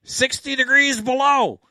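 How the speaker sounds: high, slow, loud goofball voice; goofy high voice. Retold or imitated. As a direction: play the nerdy goofball. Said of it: "And Harold said".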